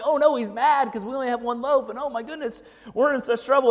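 hammed-up panicky disciples, pitch up; mock panic. Preacher voicing disciples in a mock panic. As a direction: play the disciples fretting over one loaf. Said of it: "they start freaking out like".

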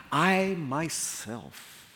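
slow, each word weighted: I myself; stress on I myself. Shown as a demonstration. Preacher reading God's own words, slow emphatic stress. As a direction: lean hard on "I myself". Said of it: "emphatically appointing the king".

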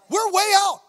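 high, loud alarmed shout at normal speed. Retold or imitated. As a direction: call it out loud and high, quick. Panicked inner cry at the meeting.

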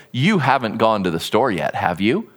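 low, pointed rhetorical question; low warning tone, ending on a loaded "have you?"; leading question, expecting no. Shown as a demonstration. Speaker daring the listener to say yes, low and pointed. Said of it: "You better say no".

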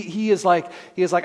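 usual speaking voice

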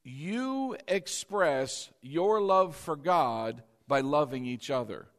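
very slow, weighing each word; slow solemn statement; slow and deliberate. Shown as a demonstration. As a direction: set the statement down carefully. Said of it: "Listen to this statement".

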